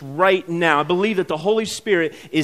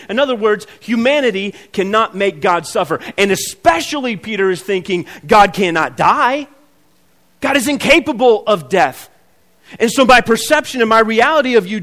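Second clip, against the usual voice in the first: raised, loud, insistent sermon delivery; fervent preaching; 5 semitones higher, 5 dB louder than usual. Acted. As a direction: drive the point home to the congregation. Preaching builds to climax.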